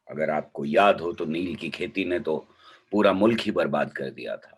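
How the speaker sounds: normal talking voice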